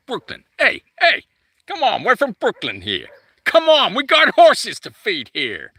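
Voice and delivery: high, loud, hammed-up Brooklyn holler; Brooklyn accent. Playing a Brooklyn horse owner at feeding time, hammed-up Brooklyn accent. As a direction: play a pushy Brooklynite calling out. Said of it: "That's more Brooklyn, isn't it?".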